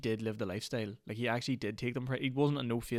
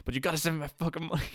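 imitating the scammer snapping, higher and louder; mock angry demand; 7 semitones higher, 3 dB louder, faster than usual. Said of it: "the next man will be like".

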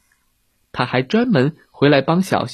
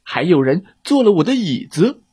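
high, complaining bear; papa bear; 6 semitones higher than usual. Acted. Papa bear finds his chair used, high complaint.

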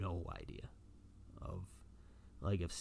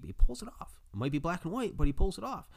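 much higher and louder, bursting with fan excitement; excited outburst; 11 semitones higher, 9 dB louder, faster than usual. Real, not acted. Fan raving to listener about Kevin Smith, excited loud burst. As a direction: cheer it like a proud fan.

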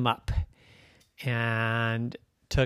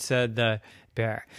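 plain reading voice

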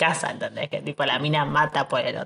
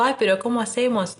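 higher-pitched mock fretting; mock helpless worry; 7 semitones higher than usual. Los soldados preguntan cómo hacer, mock helpless. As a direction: imitate helpless soldiers, pitched up. Voices the helpless soldiers' reply.

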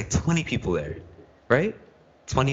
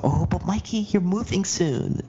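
higher, slow, sing-song imitation of a friend; mock the friend who blurted it out, pitched higher; 11 semitones higher than usual. Retelling the depression circle, imitating a friend in a higher, slower mock voice.

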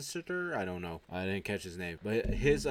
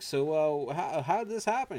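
higher-pitched puzzled question; raised voice, hesitant and puzzled; 7 semitones higher, 4 dB louder than usual. Retold or imitated. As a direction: act out a baffled guest. Acted puzzled guest asking.

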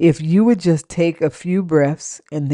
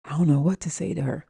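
soft, hurried mumbling to himself; introverted man; 8 dB quieter, faster than usual. Acted. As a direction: play a shy man at a loss, quietly. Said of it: "men that are introverted".

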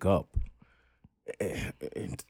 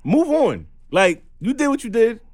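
loud, high, fed-up move on; exasperated yelling; 13 semitones higher, 6 dB louder, slower than usual. Real, not acted. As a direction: wave off the pest, voice soaring. Exasperated at a harasser.

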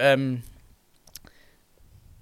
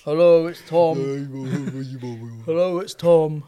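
louder put-on voice of a caller; mock phone greeting; 5 dB louder, slower than usual. A lightly voiced pretend Tom Penny calling a skater friend. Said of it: "He's gonna be like".

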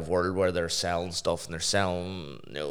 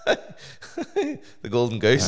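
laughing through the words; teasing through laughter; 4 semitones higher, 3 dB louder, slower than usual. Real, not acted. Friend laughing while teasing his mate.

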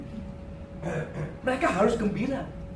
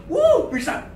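yelp of pain; 8 semitones higher, 4 dB louder, slower than usual. Acted. Acts stepping on Lego.

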